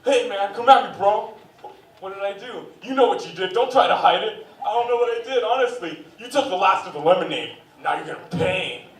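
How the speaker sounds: hammed-up fight over lemonade; customer quarrel. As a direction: customers squaring off, mock tough.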